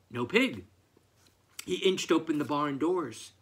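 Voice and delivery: ordinary delivery, nothing marked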